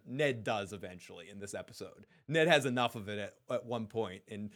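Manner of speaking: ordinary talk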